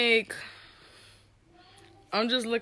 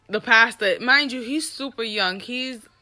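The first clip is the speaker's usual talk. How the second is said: usual speaking voice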